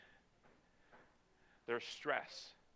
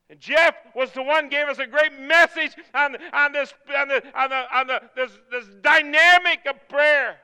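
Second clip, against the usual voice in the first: loud, rising preacher's exhortation; preaching at full force; 9 semitones higher, 14 dB louder than usual. Acted. Preacher urging the congregation at full force. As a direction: drive it home to the congregation.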